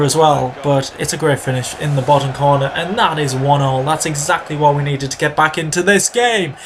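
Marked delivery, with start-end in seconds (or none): none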